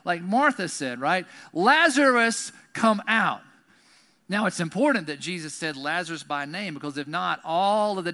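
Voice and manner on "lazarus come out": loud, raised, stretched-out shout; commanding call. Acted. Jesus summoning Lazarus by name, commanding shout. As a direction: summon him by name at full voice.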